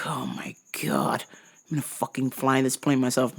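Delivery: hushed mock determination; whisper. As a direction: whisper it as a secret vow. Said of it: "I was like".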